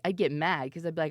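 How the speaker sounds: usual speaking voice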